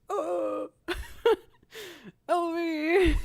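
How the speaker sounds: high-pitched, hammed-up wail for help; whimpering, exaggerated tearful plea; mock crying. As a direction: imitate a crying child pleading with dad. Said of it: "crying to my dad".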